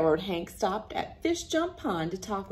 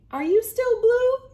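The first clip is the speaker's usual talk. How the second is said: high loud worried ask; eager question; 9 dB louder than usual. Acted. Hank asks eagerly.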